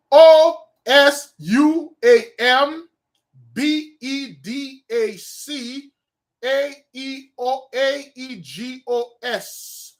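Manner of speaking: high, slow, loud chant of magic letters; incantation. Acted. High priest chanting words of power, slow, high and loud. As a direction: intone each letter like a spell.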